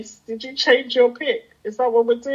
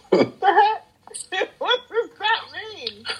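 baffled; 10 semitones higher, slower than usual. Real, not acted. Baffled by the naughty line.

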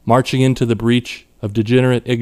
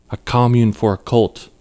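plain reading voice

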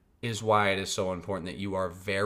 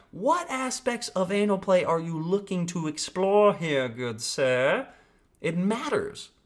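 much higher, hammed-up polite questioner; mock gentleman; 9 semitones higher, 3 dB louder, slower than usual. Host teasing a vague lover, mock genteel high voice. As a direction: pose it like a prim gentleman, dragging out good sir.

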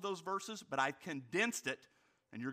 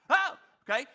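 mock surprise; 10 semitones higher, 10 dB louder, faster than usual. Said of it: "and go".

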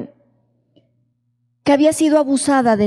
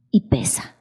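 whisper; 12 semitones lower, 4 dB quieter than usual. Real, not acted. Preacher stresses the weight of pain.

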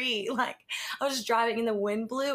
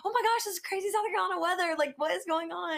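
excited; 9 semitones higher, faster than usual. Acted. Faking alarm to wake husband.

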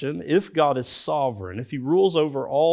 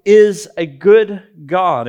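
slow, loud, higher proclamation; emphatic declaration; 3 semitones higher, 9 dB louder, much slower than usual. Shown as a demonstration. Pastor shows how believers declare God's goodness, slow and loud. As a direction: proclaim it like a bold creed. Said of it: "We may even say it emphatically".

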